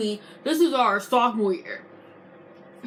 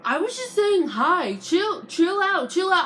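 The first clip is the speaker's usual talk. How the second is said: high, whiny mock voice brushing it off; higher mock voice telling her to chill; mocking her dismissive tone; 8 semitones higher than usual. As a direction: imitate the girl waving it away. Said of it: "She's like".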